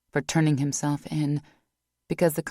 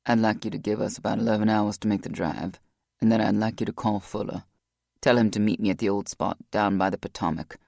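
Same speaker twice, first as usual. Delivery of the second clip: lower, heavy-hearted man making up his mind; Rock, low and resolved; 6 semitones lower than usual. A narrator's reading.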